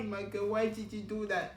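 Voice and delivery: usual speaking voice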